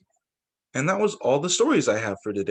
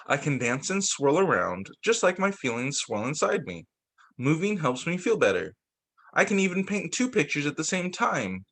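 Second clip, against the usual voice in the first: gentle picture-book read-aloud; reading to children. A narrator's reading. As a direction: read it like storytime for little kids.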